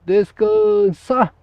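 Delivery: loud barked parade command, last word long and drawn out; military drill command. Shown as a demonstration. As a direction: call it like a drill sergeant, stretching the final word. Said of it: "ele vai falar".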